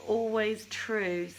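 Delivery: ordinary talk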